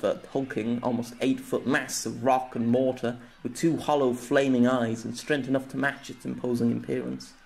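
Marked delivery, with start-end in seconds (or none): none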